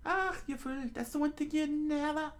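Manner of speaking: put-on very high falsetto girl voice; alarmed high-pitched warning; Nami scolding. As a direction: scold the fool in a squeaky female falsetto. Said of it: "Nami before Buggy interrupted".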